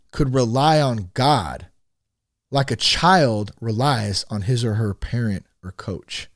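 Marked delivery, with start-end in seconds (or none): none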